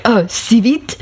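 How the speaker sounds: retold French-accented shop request. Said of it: "saying English words in a French accent".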